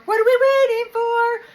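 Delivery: high, loud, slow squeaky echo of the question; mock squeal. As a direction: squeal it like an impatient kid. Repeats viewer's question in mock voice.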